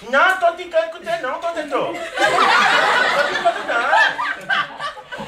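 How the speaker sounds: high, garbled speech with T replacing most consonants; hammed-up tongue-tied boy, consonants all turned to T; imitated speech impediment. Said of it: "gente, ele fala assim".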